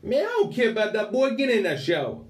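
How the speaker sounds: high, loud barked order in a put-on accent; yelling prison officer. Prison officer ordering inmate into the shower, loud high yell. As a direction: boss the new inmate around, voice way up. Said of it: "I do apologise for the accents".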